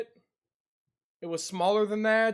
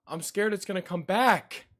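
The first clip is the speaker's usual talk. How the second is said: usual speaking voice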